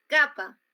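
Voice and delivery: capa called out high and loud, clearly split into ca-pa; loud demo word. Shown as a demonstration. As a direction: announce capa loudly like writing it on the board. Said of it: "la palabra capa".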